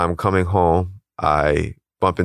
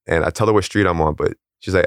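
ordinary talk

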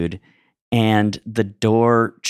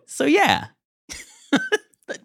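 laughing; 9 semitones higher than usual. Real, not acted. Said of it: "That's great".

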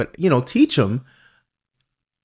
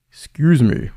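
mock big-dude voice, pushed louder; deep voice; 5 semitones higher, 5 dB louder than usual. Big former pupil approaching his old teacher, mock deep voice. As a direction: lightly imitate a big man hailing someone. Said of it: "with this deep voice".

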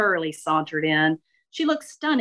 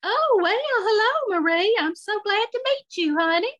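much higher, excited and uncomfortable; Shirley greeting Marie, voice jumping an octave higher. Acted. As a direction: squeak it up an octave, nervously sweet. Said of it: "Her voice went up an octave".

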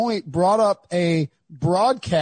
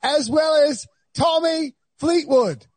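high-pitched mock British presenter; Trevor Noah; 8 semitones higher, slower than usual. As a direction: put on a hammed-up British accent, welcoming guests. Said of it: "Trevor Noah is doing the day show".

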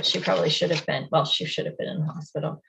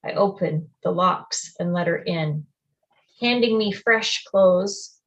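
usual speaking voice

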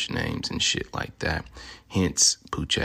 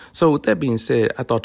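louder and higher, winding up; loud build-up; 7 semitones higher, 8 dB louder than usual. Acted. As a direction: punch it up like a hype man. Building up to the bit.

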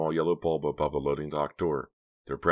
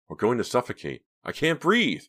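starting to panic; 9 semitones higher, 5 dB louder than usual. A narrator's reading. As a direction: pitch climbing into a loud, high cry. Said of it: "starting to panic".

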